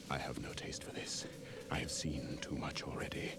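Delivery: whispered, tired and sick of war; weary whisper. Acted. Namer turning from the battle, weary whisper. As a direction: breathe it out as a soft whisper.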